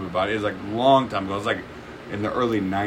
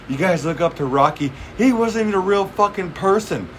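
mock rant; 9 semitones higher than usual. Acting out Bill Burr's Philly rant.

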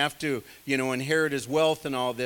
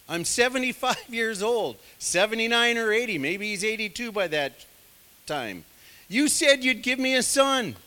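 higher, louder whiny complaint; whining to God; 7 semitones higher, 4 dB louder than usual. Retold or imitated. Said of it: "ever whined to the Lord".

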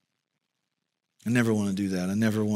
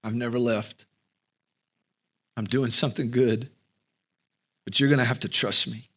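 tender. Pretend. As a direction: whisper it like a loving comfort. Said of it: "sweetly, tenderly saying".

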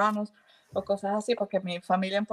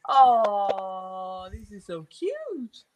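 enternecida; much slower than usual. Real, not acted. Enternecida por la primera nieta.

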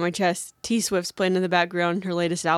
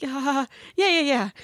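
nervous high yeahs; 7 semitones higher than usual. Retold or imitated. Faint but insisting she's fine.

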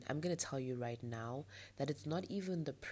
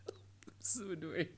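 laughing annoyed; 3 semitones higher than usual. Real, not acted.

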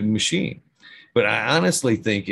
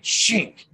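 sound effect; 12 semitones higher than usual. Pretend. Mimicking chop sound.

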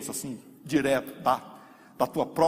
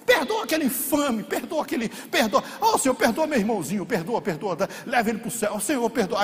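higher, begging with a crack of laughter; tearful pleading prayer; 8 semitones higher, 3 dB louder than usual. Retold or imitated. Believer weeping in prayer for the brother who hurt him. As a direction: cry out forgiveness over and over. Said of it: "chorando por ele".

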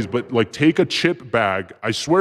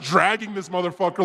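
ordinary talk

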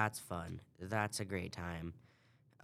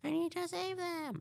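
mock Abby. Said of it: "she's like".

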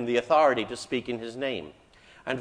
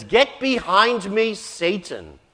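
angry rebuke; 5 semitones higher, 5 dB louder, slower than usual. Retold or imitated. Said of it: "He turns on him in anger".

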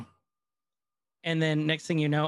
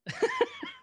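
high laugh. Real, not acted. Laughs after bad pause.

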